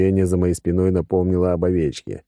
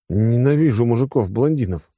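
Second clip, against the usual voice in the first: ordinary delivery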